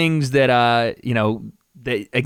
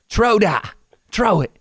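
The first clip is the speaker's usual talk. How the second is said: higher-pitched, muddled Irish-British impression; bad Connor accent; 8 semitones higher, 3 dB louder, slower than usual. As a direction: coach someone in a wobbly mock Irish accent. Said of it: "I don't know what accent I'm doing".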